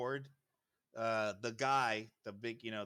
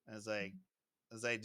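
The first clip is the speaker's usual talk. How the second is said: ordinary delivery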